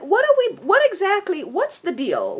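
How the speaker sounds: incredulous, near a squeal; exasperated high question. Real, not acted. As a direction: pitch jumps up high in disbelief. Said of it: "rhetorical outrage".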